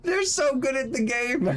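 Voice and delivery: put-on high, sweet kid voice, lightly done; a small boy's earnest praise, pitched high; imitating a child. Streamer repeating a child fan's words, high childlike voice. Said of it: "I like that he said".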